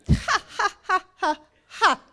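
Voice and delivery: high, separated staged "ha"s; mocking fake laugh. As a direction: laugh derisively in their faces. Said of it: "Laugh in their face".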